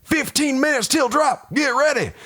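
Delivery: high shouted call; shouting orders. Acted. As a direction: yell it over the engines. Sergeant calling out before drop.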